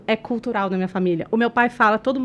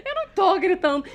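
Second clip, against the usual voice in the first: high, fast protest through laughter; laughing mock yell of denial; shouted denial; 8 semitones higher, faster than usual. Re-enacting her argument for the listeners, laughing mock shout. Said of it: "gritou mais ainda".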